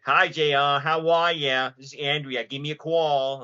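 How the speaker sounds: put-on loud, high-pitched New York accent. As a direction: exaggerate a New York Italian girl's accent on a voicemail. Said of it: "that accent is, like, a deal breaker".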